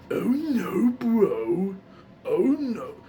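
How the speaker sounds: early, rougher attempt at the gravelly character; Muscle Man. Shown as a demonstration. Recalling first attempts for viewers, beginner's Muscle Man voice. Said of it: "when I first was learning him".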